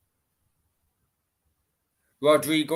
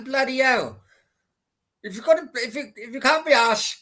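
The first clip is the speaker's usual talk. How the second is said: exasperated; 8 semitones higher than usual. Real, not acted. Fan angry at lazy striker.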